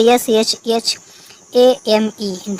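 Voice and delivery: letters ए एम ई spelled one by one, slowly; slow, deliberate letter-by-letter spelling. Shown as a demonstration. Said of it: "इसी तरीके से".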